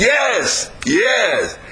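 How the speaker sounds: high, drawn-out yes; demonstrating an eager, rousing answer; enthusiastic. Shown as a demonstration. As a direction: show them real enthusiasm, pitch leaping up. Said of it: "you want to get that enthusiasm in there".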